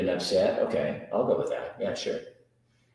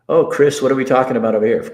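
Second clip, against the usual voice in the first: calling out; 5 semitones higher, 10 dB louder, much faster than usual. Real, not acted. Calling out to a chatter.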